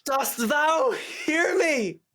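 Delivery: put-on high, very slow old-fashioned call; hammed-up olde English shout; mock archaic yell. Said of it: "I'm yelling as loud as I can".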